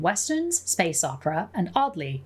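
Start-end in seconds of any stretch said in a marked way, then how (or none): none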